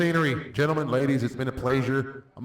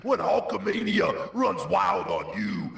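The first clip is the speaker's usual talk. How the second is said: Hulk Hogan impression; 6 semitones higher than usual. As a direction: shout it like a pro wrestling promo. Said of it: "That clip brought to you by".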